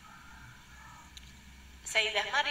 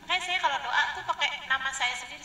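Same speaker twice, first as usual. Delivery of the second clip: ordinary talk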